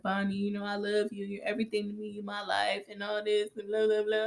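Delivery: soft, hushed lovey voice; softly mocking a tender confession. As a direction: murmur it like a sappy bedside vow. Frank's tender bedside declaration.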